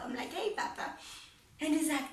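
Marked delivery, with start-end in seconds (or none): 0.3-0.9 put-on high, girlish "Hey, Papa"; sweet little daughter. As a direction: greet dad like a little girl again. Daughter greeting her dad.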